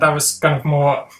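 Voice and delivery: ordinary talk, nothing marked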